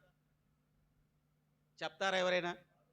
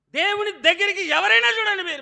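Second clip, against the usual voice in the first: raised, ringing sermon voice; preaching; 11 semitones higher, 11 dB louder than usual. Acted. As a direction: call it out to the congregation. Preaching call out.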